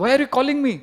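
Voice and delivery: playful exasperated protest; mock exasperation. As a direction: act out a pestered son protesting. Son complains at repeated calls.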